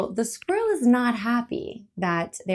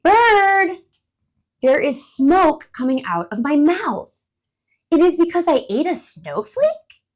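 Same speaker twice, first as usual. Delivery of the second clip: much louder, high worried cry; worried squirrel; 8 semitones higher, 9 dB louder than usual. Acted. Worried alarmed squirrel. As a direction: squirrel alarmed by his breath, asks bird.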